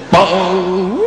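comic sledgehammer clang, pitched high; high "bong" sound. Retold or imitated. Swinging a sledgehammer at the cushions, high imitated bong. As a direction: let out a high, ringing "bong".

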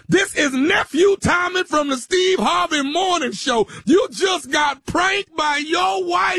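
high, triumphant radio announcement; gleeful host springing the surprise; shouted prank reveal. Real, not acted. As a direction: shout the reveal, stretching the end.

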